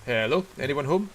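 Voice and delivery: loud call into an empty house; calling down a hallway. Real, not acted. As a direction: call out to see if anyone's in.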